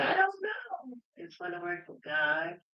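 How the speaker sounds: put-on high, quiet, tearful little girl; a small child close to tears. Woman voicing her young self, high and tearful. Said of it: "I said".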